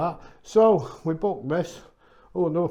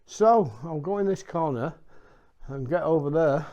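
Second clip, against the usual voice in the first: normal talking voice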